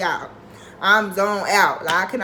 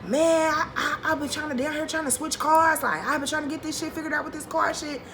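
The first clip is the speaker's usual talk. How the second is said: higher, casual put-on voice playing it cool; mock nonchalant boyfriend; 7 semitones higher than usual. Boyfriend on the phone playing it cool, nonchalant mock voice. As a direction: imitate him brushing it off lightly. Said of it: "Trying to be, like, nonchalant".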